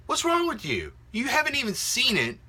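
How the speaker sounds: high, exasperated What's wrong with you; incredulous scolding. Retold or imitated. Vlogger retelling what he asked forum users, incredulous. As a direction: scold the forum posters in disbelief. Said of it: "asked people on these forums going".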